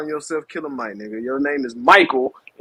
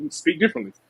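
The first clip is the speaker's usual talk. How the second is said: usual speaking voice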